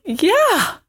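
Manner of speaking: high, laughing, insisting everything's fine; a high, brittle denial through tears; forced fine. Retold or imitated. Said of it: "And I was like".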